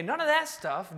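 ordinary talk, nothing marked

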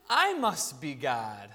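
low, slow, smug conclusion; self-important cat. Acted. Cat concluding from attention that it is divine, smug. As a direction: drop low and savour your own grandeur. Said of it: "The cat goes".

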